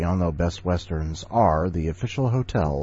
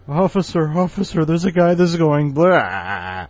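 high, loud frantic calling for the officer; a bystander shrieking to get help, pitched way up; panicked stranger; 9 semitones higher, 7 dB louder than usual. Pretend. A bystander calling to a police officer for help, high and frantic.

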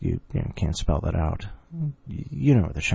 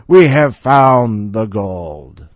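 dramatic explorer voice; 2 semitones higher, 11 dB louder, much slower than usual. Acted. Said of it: "maybe I should have".

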